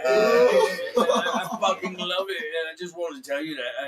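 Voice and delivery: loud, high British rock-star impression. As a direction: stammer and holler like a thrilled old rocker. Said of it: "Act like [name]".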